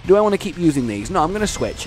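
ordinary talk, nothing marked

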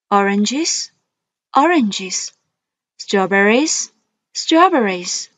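each word twice, rising then falling; list reading tune. Shown as a demonstration. Teacher modelling vocabulary for students, rise then fall. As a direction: model words for the class to repeat. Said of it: "Please read after me".

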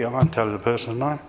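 normal talking voice

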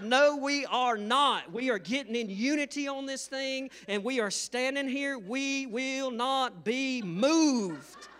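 high, loud mock-defiant proclamation; hammed-up stubborn husband holding his ground; arguing. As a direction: stand firm, stretching the final words slowly. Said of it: "me and her got in an argument".

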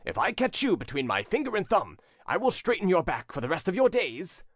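stentorian threat. A narrator's reading. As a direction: threaten in a big public voice. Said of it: "cried a stentorian voice".

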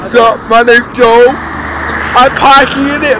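loud, brash camera intro; brash shouted greeting for the camera. Acted. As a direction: announce yourself loudly to the lens. Introducing himself loudly to camera.

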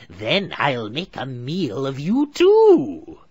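high, very slow, loud sneer; a hag judging her prey; witch voice. A narrator's reading. Witch inspects caged Hansel, slow and sneering. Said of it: "she told Gretel grimly".

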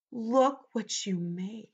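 plain reading voice

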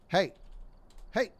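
usual speaking voice

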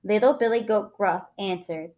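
usual speaking voice

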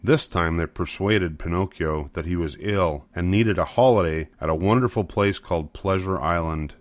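ordinary delivery

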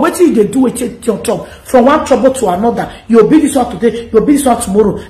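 normal talking voice

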